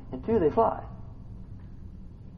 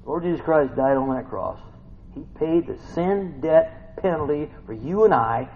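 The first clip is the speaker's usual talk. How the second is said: ordinary delivery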